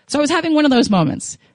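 ordinary talk, nothing marked

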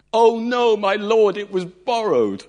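loud, high mock panic; panicked cry. Man telling prophet the lost axe was borrowed, loud panic. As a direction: wail it out in alarm.

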